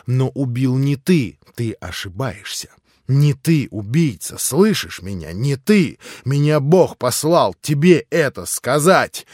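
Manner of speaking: rising ecstatic insistence; beside himself. A narrator's reading. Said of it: "как бы вне себя".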